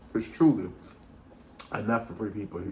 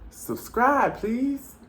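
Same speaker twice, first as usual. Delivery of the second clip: much higher, sing-song little plea; cutesy sign-off; 14 semitones higher, slower than usual. Real, not acted. Vlogger begging viewers to subscribe, squeaky high. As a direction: squeak out a sweet begging outro.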